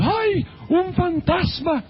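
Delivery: high, drawn-out frightened wail; hammed-up cry of alarm, stretching the words; acted fear. Disciples crying out at a supposed ghost, acted fright. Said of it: "se llenaron de temor".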